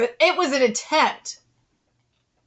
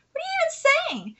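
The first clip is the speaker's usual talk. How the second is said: high-pitched disbelief; incredulous outburst; 13 semitones higher than usual. Acted. Aunt to boy refusing dinner, high incredulous protest. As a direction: squeak it out, baffled by the refusal.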